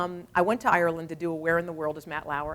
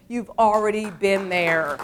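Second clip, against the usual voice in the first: normal talking voice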